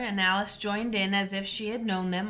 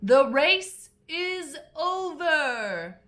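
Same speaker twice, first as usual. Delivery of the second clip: higher, slow, loud announcement; calling out; 7 semitones higher, 5 dB louder, much slower than usual. A narrator's reading. Dodo announces the race's end to all, calling out. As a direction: call it out grandly like an official. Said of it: "the dodo suddenly called out".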